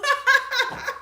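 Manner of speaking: high-pitched real giggle; high laugh. Real, not acted. Woman amused by her own story about mama, high laughing. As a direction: laugh in a high, rapid run.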